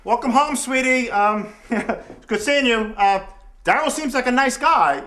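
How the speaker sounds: loud, high, overbright welcome; awkward cheeriness. Acted. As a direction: cover the embarrassment with loud pleasantries. Father covers awkward first meeting.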